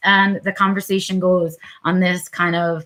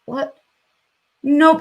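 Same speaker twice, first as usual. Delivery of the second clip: startled refusal; 6 semitones higher than usual. Retold or imitated. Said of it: "you just go".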